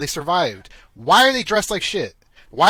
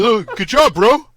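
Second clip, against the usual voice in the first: louder, higher goofy voice with a laugh; a dopey robot's fist-bump praise, laughing; mock cheery robot; 5 semitones higher, 8 dB louder than usual. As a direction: ham up a silly robot buddy. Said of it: "He's like".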